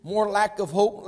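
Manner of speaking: usual speaking voice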